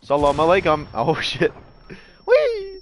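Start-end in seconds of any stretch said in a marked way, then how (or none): 2.3-2.8 high delighted whee; gleeful squeal. Real, not acted. Gleeful squeal while jumping.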